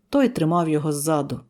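usual speaking voice

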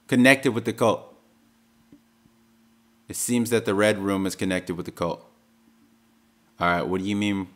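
normal talking voice

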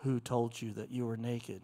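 very low, soft God voice. Acted. God questioning Adam and Eve, deep voice. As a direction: drop deep and quiet, a knowing divine question.

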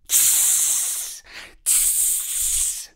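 soft snake hiss. A narrator's reading. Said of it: "snake hiss".

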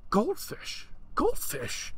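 put-on high, thrilled kid screaming; excited screaming. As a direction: shout it like a delighted kid. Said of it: "starts screaming".

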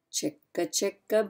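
usual speaking voice

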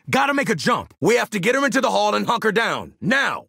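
high, hurried combat command; rally the squad to move immediately; urgent order. Acted.

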